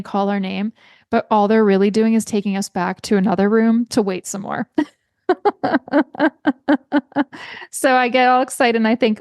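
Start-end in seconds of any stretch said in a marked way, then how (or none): 5.3-7.6 a bright, higher-pitched burst of laughter. Real, not acted. Amused at waiting in yet another room, high laugh. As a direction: laugh at the silliness of it.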